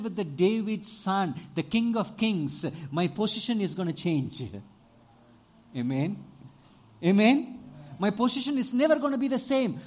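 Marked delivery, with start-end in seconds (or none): none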